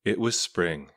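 ordinary delivery, nothing marked

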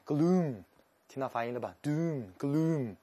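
slow dark drawn-out "gloom" and "Doom"; gloomy sounding words. Shown as a demonstration. Teacher draws out "gloom" so students hear its gloom, slow and dark.

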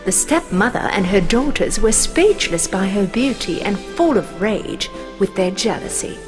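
usual speaking voice

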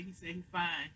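ordinary talk

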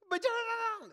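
high, loud blubbered protest; mock crying child. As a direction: imitate a child bursting into a wail. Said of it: "she starts crying".

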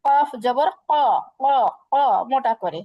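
ক said heavy, mouth rounded and full; heavy kaf. Shown as a demonstration. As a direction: round the lips and say ক thick and heavy. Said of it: "মোটা করতে হয়".

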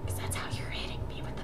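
whispering the joke under breath; whispered aside. Real, not acted. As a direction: drop to a quick whisper.

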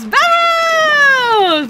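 long high shout; stretched battle cry; rallying shout. Real, not acted.

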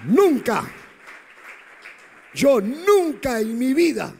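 loud, high cry on "Nunca"; emphatic preaching. Real, not acted. Preacher's emphatic personal vow.

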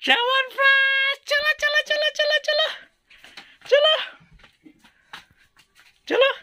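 very high-pitched, gleeful chant; rally everyone in a squealing high voice; excited rallying. Real, not acted. Father urging child along for Chyawanprash, excited rallying.

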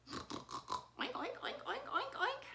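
grunting pig noises; pig oinks. Acted. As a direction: oink like a little pig.

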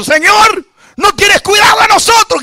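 high, loud cry of reproach; acted yell of complaint; scolding shout. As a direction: shout it at him like frightened disciples scolding. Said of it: "gritándole".

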